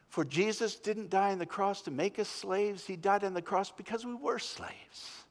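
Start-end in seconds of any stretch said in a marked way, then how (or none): none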